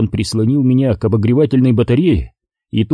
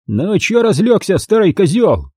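put-on high, sneering young thug voice; mocking thug; 8 semitones higher than usual. Thug sneers at the beaten narrator in a high mocking voice. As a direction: jeer down at the old man on the ground.

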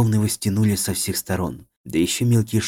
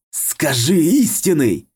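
slow, much louder menacing order; dark lord; 8 semitones higher, 6 dB louder, much slower than usual. A narrator's reading. Possessed man demands.

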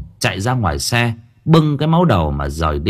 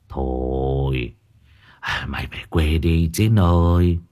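put-on low, soft, weary old man; sighing; 7 semitones lower, 4 dB quieter, slower than usual. A narrator's reading. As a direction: draw out a resigned, sighing plea. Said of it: "ông thợ già chỉ biết thở dài". (thở dài, khuyên nhủ)

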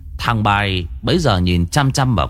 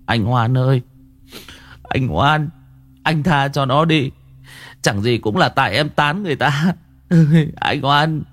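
higher, pleading younger-brother voice; imploring, raised voice with a plaintive edge; 4 semitones higher than usual. Acted. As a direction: beg your brother to forgive someone. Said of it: "ánh mắt thống thiết".

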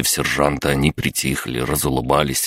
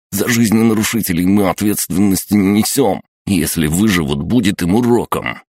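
raised, higher-pitched indignant voice; angry refusal; 5 semitones higher, 4 dB louder than usual. A narrator's reading. Sidorovets disowns the children to the sergeant, angry refusal. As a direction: snap it out high and self-righteous.

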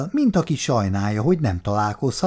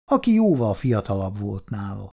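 plain reading voice

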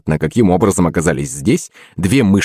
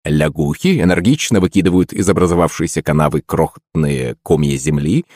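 ordinary delivery

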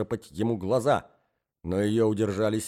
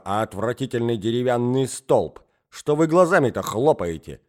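angry tirade; 3 semitones higher, 7 dB louder than usual. A narrator's reading. Wife berating husband.

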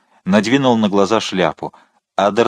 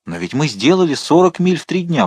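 raised-pitch protest; exclaiming; 7 semitones higher than usual. A narrator's reading. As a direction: exclaim it in higher-pitched objection. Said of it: "воскликнул Планше". (восклицая, с возражением)

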